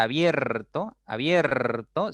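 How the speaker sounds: "abierto" stretched on "bier"; drawn-out diphthong demonstration. Shown as a demonstration. Teacher showing where the mouth opens, "abierto" drawn out. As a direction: slowly open up "abierto" on the E. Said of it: "se abre más tu boca al momento de pronunciar la E".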